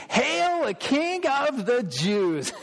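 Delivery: high, drawn-out jeering hail; mock him like the soldiers; high mocking shout of hail; mocking crowd cry. Shown as a demonstration. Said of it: "read it with, with emotion".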